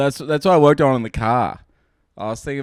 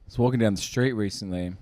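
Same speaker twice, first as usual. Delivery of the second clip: stand-up joke telling; 3 semitones lower than usual. Acted. Performs a stand-up bit.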